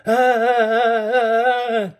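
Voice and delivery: put-on a child's high wailing cry; long high frightened wail. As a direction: wail at the top of the lungs. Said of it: "Teddy was wailing".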